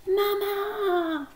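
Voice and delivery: high, long-drawn yell; huge shout. A narrator's reading. Daisy calls Mama with all her breath, huge shout. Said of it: "Daisy took the deepest breath".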